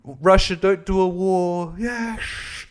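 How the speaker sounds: slow, solemn parody of a preachy comic; mock the applause-bait; drawn-out mock solemnity; mock earnest comic. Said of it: "being like".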